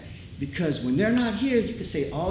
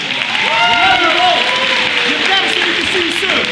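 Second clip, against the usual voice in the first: loud cheer; 10 semitones higher, 14 dB louder, faster than usual. Real, not acted. Cheering crowd for absent couple.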